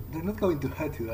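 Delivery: ordinary talk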